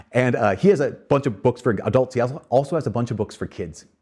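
usual speaking voice

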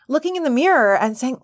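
normal talking voice

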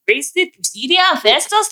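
"Priscilla" as an affected imitation; affected pronunciation of Priscilla. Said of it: "I hate the way she says Priscilla".

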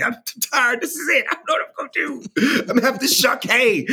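high, whiny hammed-up despair; mock crying. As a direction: wail it like a half-joking breakdown. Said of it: "and I'm crying 'cause I'm like".

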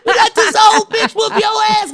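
high, loud laughing jeer; laughing crowd taunt. Retold or imitated. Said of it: "the whole crowd was like".

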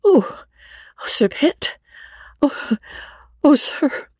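very high, broken-up phrases with long pauses; distraught young woman, gasping out words; tearful confession. A narrator's reading. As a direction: weep it out haltingly, rising to the confession. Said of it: "wept some of the most genuine tears".

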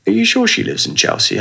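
lightly mocking police officer's question; doubtful officer. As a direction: pitch it way up, doubting cop.

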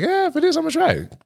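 way up high, playing the nervous girl; high mock voice.

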